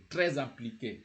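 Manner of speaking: TRÈS IMPLIQUÉ linked by a z sound; liaison. Shown as a demonstration. Teacher repeats the joined TRÈS IMPLIQUÉ for learners, liaison. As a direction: join TRÈS onto IMPLIQUÉ with a z. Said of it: "you have to join".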